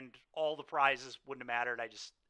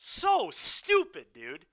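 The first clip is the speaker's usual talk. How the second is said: drawn-out, pitched-up groan of "So stupid"; high and loud frustration fading to a mutter; stretched exasperation; 4 semitones higher, 5 dB louder, slower than usual. Real, not acted. Fuming over a lost game.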